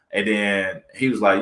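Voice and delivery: ordinary delivery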